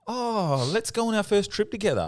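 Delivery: high-pitched gushing enthusiasm; excited invitation. Real, not acted. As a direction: propose it with sudden delight. Spontaneous invitation to first trip abroad.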